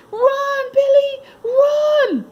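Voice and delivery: high, loud, slow yell; cheering shout. Acted. Children shouting at Billy to outrun the puppy. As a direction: urge him on across the park. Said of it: "they shouted".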